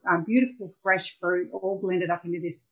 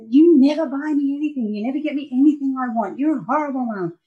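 higher, louder imitation of a sulky daughter; mock whiny accusation; 7 semitones higher, 5 dB louder than usual. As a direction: throw a teen tantrum at mum, hammed up. Daughter accusing her mum.

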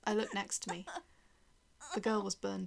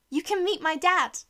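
put-on high, loud childish squeal; excited little girl; 8 semitones higher, 11 dB louder than usual. A narrator's reading. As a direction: squeal it with glee.

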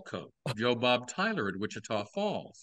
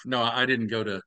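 usual speaking voice

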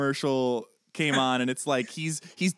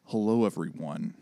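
lower, slow smooth-jazz host voice; Duke Silver impression; 5 semitones lower than usual. Host attempts her Ron Swanson as Duke Silver, low and smooth. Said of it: "my Ron Swanson Duke Silver".

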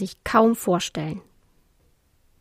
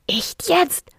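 mild mock yell; 9 semitones higher than usual. A narrator's reading. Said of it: "brüllte der nach oben".

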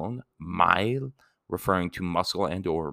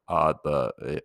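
ordinary talk

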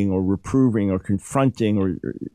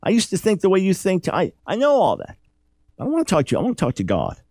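higher, exasperated Job pleading his case; voicing frustrated Job, higher; 9 semitones higher than usual. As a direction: push back at the friends like fed-up Job. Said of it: "He's saying, Look, you guys talk".

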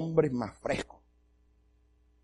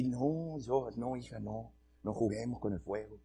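much softer, shrinking nervous no; scared refusal; 4 semitones lower, 12 dB quieter than usual. Retold or imitated. Dad nervously tells his daughter no, softly afraid. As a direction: back away from it, voice shrinking.

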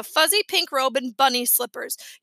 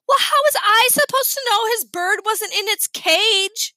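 indignant; 7 semitones higher, 5 dB louder than usual. A narrator's reading. Sheila defends herself indignantly.

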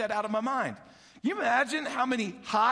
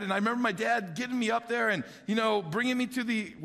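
ordinary talk, nothing marked

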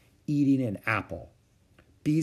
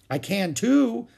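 higher defiant line round a mouthful; through a mouthful; 11 semitones higher, 5 dB louder, slower than usual. A narrator's reading. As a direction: talk back while chewing. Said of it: "said Ramona through her mouthful".

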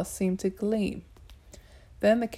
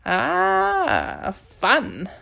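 gleeful; 5 semitones higher, 4 dB louder than usual. Acted. Cat woman gleeful in fight.

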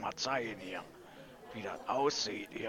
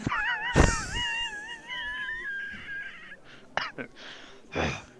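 shrieking laugh; 6 dB louder than usual. Real, not acted. Explosive laughing fit.

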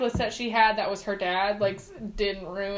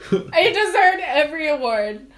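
laughing; 5 semitones higher, 6 dB louder than usual. Real, not acted. Passionate praise, laughing.

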